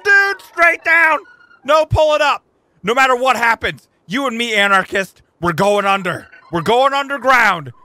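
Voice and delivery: loud, high, pumped-up orders; excited yelling. Real, not acted. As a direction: hype your partner through the dive. Urging the owl down the dive.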